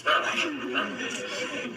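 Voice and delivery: slow voiceless brr through laughter; laughing brr imitation. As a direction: trill brr slowly while laughing. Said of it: "That's how Harrison talks".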